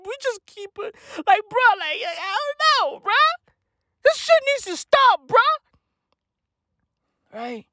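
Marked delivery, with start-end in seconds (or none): none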